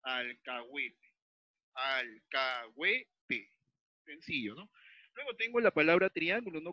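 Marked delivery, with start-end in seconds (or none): 0.0-1.1 "Alcahuete" slowed down, with "hue" kept as one syllable; measured teacher's "Alcahuete", al-ca-hue-te; slow syllabification. Shown as a demonstration. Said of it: "juntar en una sola sílaba". 1.8-3.5 "alcahuete" very slowly as al-ca-hue-te, with pauses; syllable by syllable. Shown as a demonstration. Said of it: "juntar en una sola sílaba".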